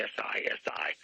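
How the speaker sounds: S I S I spelled letter by letter in a whisper; whispered spelling. Shown as a demonstration. Host spells the general's name for listeners: whispered S I S I. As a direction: spell S I S I under your breath. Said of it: "the general there is named, uh, Sisi".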